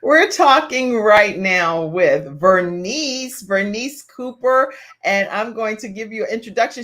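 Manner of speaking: loud, drawn-out welcome back; show host announcing. Real, not acted. Host bringing viewers back from a break, announcing. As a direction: project it slowly to the whole audience.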